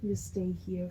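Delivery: ordinary delivery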